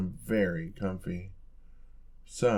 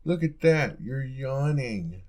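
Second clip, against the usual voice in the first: teasing exclamation; 4 semitones higher, 6 dB louder, slower than usual. Acted. Teasing partner caught yawning.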